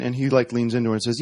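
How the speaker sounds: ordinary talk, nothing marked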